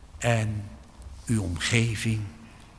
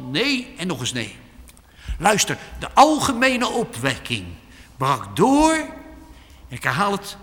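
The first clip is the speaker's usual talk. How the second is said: loud, high preaching that builds up; fiery preaching; 3 semitones higher, 5 dB louder than usual. Acted. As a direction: urge the congregation with full force. Preaching at full force again.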